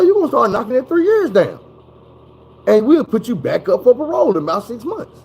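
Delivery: high, pushy lecturing officer; mock parole officer laying down the law; parole officer threat. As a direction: rattle off the threats like a cocky officer.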